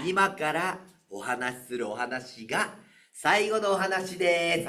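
slow, drawn-out, overly solemn; milk each word to cue the crowd's groan; exaggerated announcement. Shown as a demonstration. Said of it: "俺ものすごいわざとらしく言うからね". (わざとらしく、ゆっくりと告げる)

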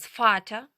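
"Фата" loud, starting on a crisp f; German V as F. Shown as a demonstration. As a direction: model "Фата" with f, rising at the end. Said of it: "вона читатиметься як звук Ф".

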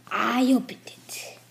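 slow whisper; whispered confession. Pretend. Admitting to friends the skull was opened, slow whisper. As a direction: whisper it slowly, drawn out.

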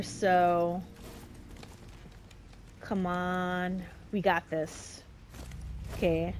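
usual speaking voice

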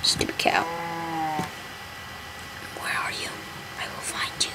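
creepy hushed threat; menacing whisper. Pretend. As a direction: whisper like a stalker. Stalking cows in game.

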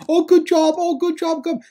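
very high, silly taunting voice; mocking jeer. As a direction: mimic a loser heckling from the bench. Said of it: "he's now the biggest loser in baseball".

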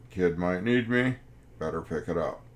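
normal talking voice

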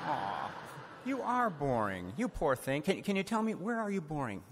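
playfully consoling the boring story; mock sympathy. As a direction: coo at it like a hurt pet. Comforting the story, laughing.

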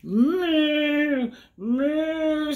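high mock moo; cow moo. Cow greeting the spider, playful high moo. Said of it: "said the cow".

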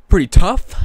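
jokey loud tough-guy burst; sudden loud burst. Real, not acted. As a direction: blurt it out big and high. Musing on wrestler name.

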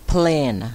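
"Plane" said alone, clearly, falling; pronunciation demo. Shown as a demonstration. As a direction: model "Plane" with its long ay vowel. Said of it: "ครูจะออกเสียง".

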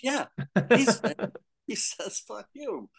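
a hint of a tiny girl cursing; mock little girl. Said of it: "The little Japanese girls say".

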